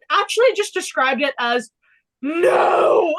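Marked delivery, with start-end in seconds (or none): 2.2-3.1 loud, higher mock-sarcastic "No!"; one drawn-out, exclaimed sarcastic denial; Oscar Piastri. Retelling the driver's cool down room answer, loud sarcastic impression. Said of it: "very sarcastic Oscar Piastri in the".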